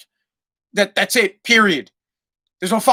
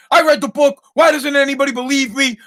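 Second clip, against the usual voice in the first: mock indignant son, higher and louder; whiny kid protest; 6 semitones higher, 3 dB louder than usual. Dad imitating his son's whiny protest. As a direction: play the son whining he read it. Son insisting, retold argument.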